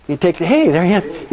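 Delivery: ordinary delivery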